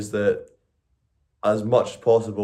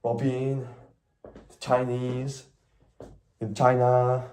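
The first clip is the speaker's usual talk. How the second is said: hesitant, lightly put-on Chinese accent; attempted Chinese accent; 3 semitones higher, slower than usual. Shown as a demonstration. Streamer testing a Chinese accent for his phone, wobbly mock accent. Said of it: "I should do a Chinese accent".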